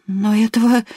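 breathless, high and strained; gasping for air. A narrator's reading. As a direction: gasp out the words. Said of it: "задыхаясь, проговорила она".

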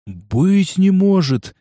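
slow, breathy, stunned; can't believe his eyes; breathed disbelief. A narrator's reading. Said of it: "неверяще выдохнул он".

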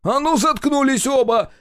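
high-pitched shout at both; exasperated yell. A narrator's reading. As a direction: lose patience and yell them silent. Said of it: "не выдержал и заорал я".

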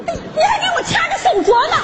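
high, laughing indignation; indignant complaint. Acted. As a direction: protest through a laugh. Skit quarrel over watch and bracelet.

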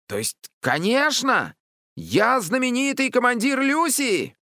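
very high, swelling proclamation; boastful child commander. A narrator's reading. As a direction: proclaim your own fame proudly. Flattered boy commander declaims. (хвастливый звонкий выкрик)

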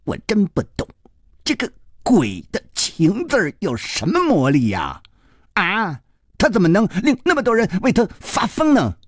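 put-on high-pitched, incredulous boy's questions; laughing scornful boy. A narrator's reading. Boy baffled by love's power, scornful high voice. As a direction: scoff in a boyish voice. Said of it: "然后又大声笑着说".

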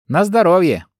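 high, loud, smirking wish. Acted. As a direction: say it with a sly smirk. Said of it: "ухмыльнулась я".

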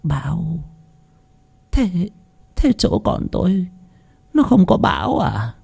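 frail, hushed bewilderment; puzzled old woman. A narrator's reading. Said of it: "đoạn nhíu mày".

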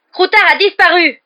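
high, loud accusing shout; frantic, furious shouting; mad with rage. A narrator's reading. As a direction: yell it as an accusation. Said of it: "fou de rage".

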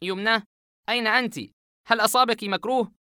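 high, shaken calling out to her; alarmed, a man stunned by her voice; shocked. Acted. Asem shocked to hear Yumna on the phone, high and alarmed. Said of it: "عاصم وهو مصدوم".

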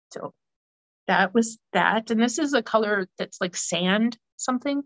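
usual speaking voice

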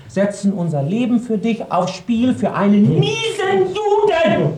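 climbing to a high, loud shout on the last word; furious shout. Acted. Scolding the man she hides, rising to a furious high shout. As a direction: rant that explodes into a shriek. Ranting angrily at the hidden man.

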